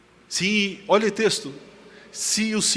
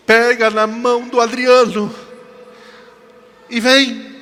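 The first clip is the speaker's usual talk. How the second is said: loud, slow, raised summons; voice from the dream; 6 semitones higher, 10 dB louder, slower than usual. Retold or imitated. Voice tells the frightened woman how to cross, loud slow summons. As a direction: proclaim it slowly as a commanding call. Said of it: "E a voz falou assim".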